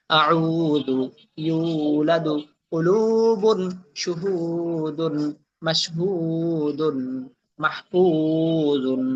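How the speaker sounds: slow word list with long held vowels; elongated vowels. Shown as a demonstration. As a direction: stretch the madd in every word. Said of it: "এই টান দিবেন". (টান দিয়ে একটা একটা শব্দ পড়া)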